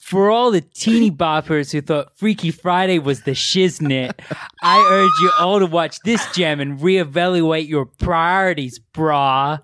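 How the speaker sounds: put-on loud cocky frat boy read; frat bro. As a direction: hype it up like a dude bro. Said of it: "Read it like a frat boy".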